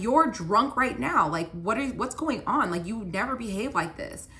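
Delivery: worked-up mock confrontation, easing toward the end; getting mad. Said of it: "now I'm getting mad".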